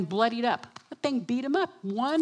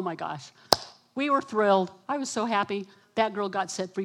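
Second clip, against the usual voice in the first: ordinary talk, nothing marked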